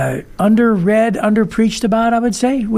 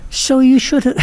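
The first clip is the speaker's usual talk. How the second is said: laughing; 6 semitones higher than usual. Pretend. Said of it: "The Oliver voice".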